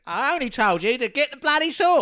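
high, loud gangster quote; hammy cockney movie line; Michael Caine impression. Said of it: "Get Carter for sauce".

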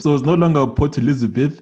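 normal talking voice

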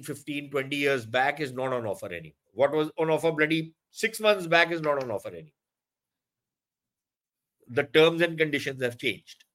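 ordinary talk, nothing marked

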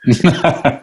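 high amused laugh; teasing laugh. Real, not acted. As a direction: burst out laughing.

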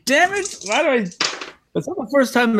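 high, exasperated yelp; frustrated squeak. Real, not acted. Frustrated outburst.

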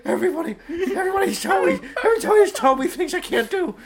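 high, loud, laughing put-on voice; silly high voice. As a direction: squeal it out like a cartoon. Voicing Paul Giamatti, high and laughing.

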